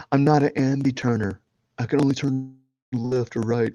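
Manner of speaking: lightly voiced movie-quote delivery; understated imitation of a vain model; Zoolander quote. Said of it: "What was it?".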